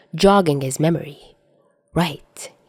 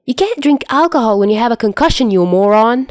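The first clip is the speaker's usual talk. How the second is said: higher, faster angry scolding; irritated shout; 5 semitones higher, 4 dB louder than usual. Acted. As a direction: scold him hard, fast and loud. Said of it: "irritation bubbling in him too".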